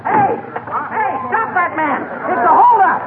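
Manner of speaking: high yelling for help; shouting. Acted. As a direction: shout after the thief. Chasing robber, calling officer.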